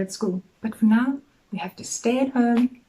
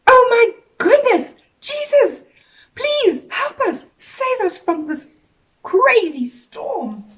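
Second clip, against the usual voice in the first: high, loud, panicked cry for help; frightened plea; 11 semitones higher, 6 dB louder than usual. Acted. Friends in the storm begging Jesus, high panicked plea. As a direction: beg for rescue in terror. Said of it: "Jesus' friends got such a fright".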